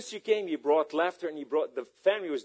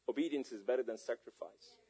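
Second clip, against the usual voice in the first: ordinary talk, nothing marked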